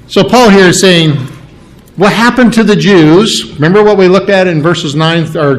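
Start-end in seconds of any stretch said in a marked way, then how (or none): none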